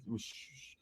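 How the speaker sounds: voiceless stammered whisper; nervous whisper. Retold or imitated. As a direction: breathe it so he can't hear. Whispered worry.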